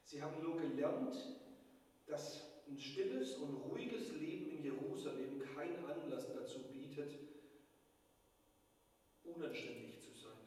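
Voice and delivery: ordinary delivery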